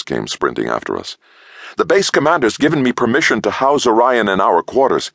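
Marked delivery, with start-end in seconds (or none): 1.8-5.1 high, hurried, breathless; panting. A narrator's reading. Scientist breathless after sprinting after them. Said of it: "he panted".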